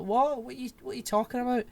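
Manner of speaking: high, rushed startled questions; mock bewilderment. Retold Brad answering his partner, mock bewilderment. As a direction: blurt it in comic confusion.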